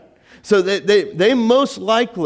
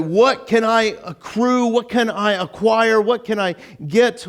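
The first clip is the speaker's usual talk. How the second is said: higher-pitched, insistent questions; acted hunger for more; acquisitive; 6 semitones higher than usual. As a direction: press each question with eager want. Voices worldly grasping desire.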